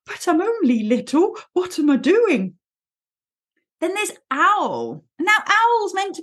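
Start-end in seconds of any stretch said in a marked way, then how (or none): 0.0-2.5 timid Piglet. Retold or imitated. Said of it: "you get your Piglet going".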